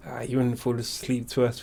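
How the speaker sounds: usual speaking voice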